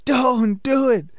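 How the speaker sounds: high, slow shouted warning at the screen; mock movie-house shout. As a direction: yell at the character on screen. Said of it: "you just hear people in the movie house shouting".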